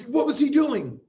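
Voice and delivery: high, loud disbelief; incredulous. Real, not acted. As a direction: ask in disbelief. Incredulous question.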